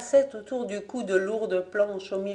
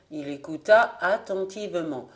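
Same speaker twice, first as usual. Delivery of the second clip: usual speaking voice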